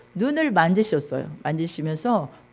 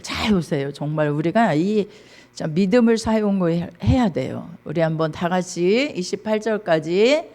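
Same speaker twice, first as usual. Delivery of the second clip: ordinary talk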